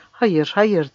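ordinary delivery, nothing marked

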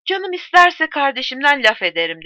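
proud and defiant; haughty. A narrator's reading. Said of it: "Longin azametle baktı". (kibirli, yüksek sesle meydan okuma)

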